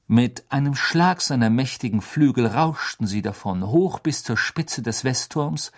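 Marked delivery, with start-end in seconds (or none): none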